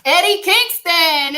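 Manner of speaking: loud, slow, high winner announcement; announcer reveal. Acted. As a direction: belt the name out like a ring announcer.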